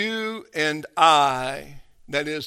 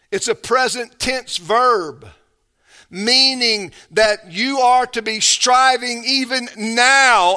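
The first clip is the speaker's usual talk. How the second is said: louder, higher preaching push; fervent preaching; 5 semitones higher, 7 dB louder than usual. Acted. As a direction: drive the point home from the pulpit. Preaching with rising force.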